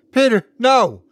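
high, loud cry of "no"; yelling. A narrator's reading. Wife yelling at husband not to open the closet, loud and high. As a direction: cry out to stop him. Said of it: "she started to yell".

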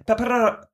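"Pepper-" pitched high, with a trilled R; rolled R. Shown as a demonstration. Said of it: "rolling my R's".

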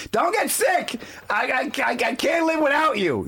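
high desperate plea; pleading shout. Retold or imitated. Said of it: "you can".

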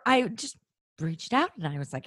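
ordinary talk

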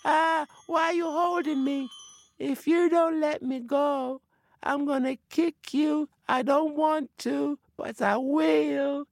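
high-voiced spirit pleading and warning; trapped spirit voice. A narrator's reading. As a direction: threaten in a high, thin spirit voice. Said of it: "said the voice".

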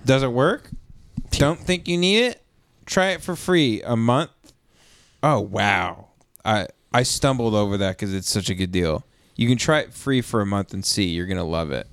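upbeat sponsor read. Acted. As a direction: sell it like an ad.

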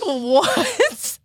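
high-pitched incredulous "what"; astonished, voice shooting up; retold disbelief. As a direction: squeal it in mock disbelief. Stunned reaction to nanny's refusal.

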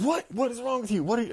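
high-pitched mock outrage; outraged principal. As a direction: act out an appalled boss scolding. Imitating principal's outraged reaction.

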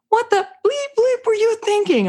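high-pitched retold indignation; mock outrage. Mother practicing what to say to her son, mock outrage. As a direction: imitate an exasperated mom's first botched attempt.

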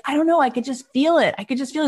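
high, gushing conviction; acted-out excited certainty; eager insistence. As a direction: blurt it out with retold youthful enthusiasm. Acting out Brian's eager pitch.

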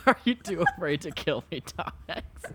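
title squeezed out through breathless laughter, trailing away; laughing. Real, not acted. Host repeating the co-host's absurd title, laughing. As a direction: read it while helplessly cracking up.

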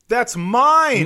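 high, loud joking protest; mock outraged protest. Real, not acted. Friend pretending it's his number, loud mock protest. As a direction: yell it as a playful accusation.

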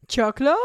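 CHOCLO loud with rising, questioning end; tentative question. Retold or imitated. To puzzled line cooks, tentative rising question. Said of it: "And I'm like".

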